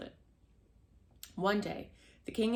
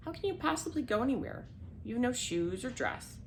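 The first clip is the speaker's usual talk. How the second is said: lightly scolding, higher-pitched refusal; a touch of incredulous disdain, pitched up; scornful stepmother; 6 semitones higher than usual. A narrator's reading. Stepmother refusing to let Cinderella go to the festival, mildly scornful.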